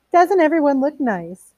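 high, slow, sugary hostess voice; Mildred gushing at her husband, high and slow; gushing wife. Acted. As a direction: coo it like a hostess showing off. Mildred gushing over her guests.

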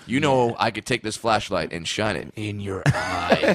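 low, menacing mock authority; hammed-up low threat; mock guard threat. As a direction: play the power-tripping guard low. Joins in mocking guard's threats.

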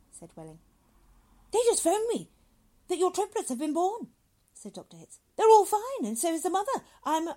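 high, loud, gushing good cheer; jovial doctor. Acted. Doctor tells waiting father of triplets, loudly jovial. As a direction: boom the good news effusively.

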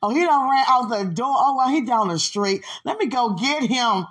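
high, loud mock alarm; excited joke. Joking about him running down the street, high and loud. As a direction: play it like he's really gone.

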